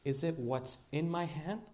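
a hushed, patient question to Jonathan; God's voice, quiet. Retold or imitated. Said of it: "this is what I heard God say".

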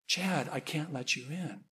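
very soft, reluctant no; quiet refusal. Retold or imitated. Mother refusing her son at the wall, quiet refusal. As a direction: refuse him gently, voice dropped right down. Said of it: "she shakes her head and she says".

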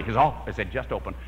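normal talking voice

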